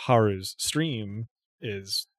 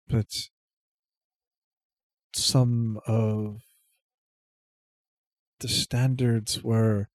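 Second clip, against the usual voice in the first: vague. Acted. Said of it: "sounding a little vague".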